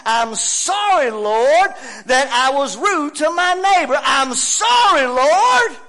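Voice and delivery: high, loud preacher's cry; impassioned shouted plea to God; shouted confession. Acted. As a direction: cry out the apology at full force. Climax of acted confession.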